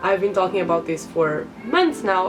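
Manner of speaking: ordinary talk, nothing marked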